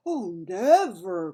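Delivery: loud, slow, earnest protest; passionate cry. A narrator's reading. BFG protests to Bloodbottler, loud and passionate. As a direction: plead your innocence with fervor. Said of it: "cried the B F G passionately".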